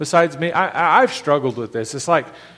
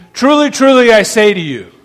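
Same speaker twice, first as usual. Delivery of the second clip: emphatic quote; 4 semitones higher, 6 dB louder than usual. Retold or imitated. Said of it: "did you hear Jesus say".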